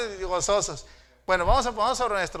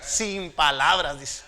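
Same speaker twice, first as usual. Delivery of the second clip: ordinary delivery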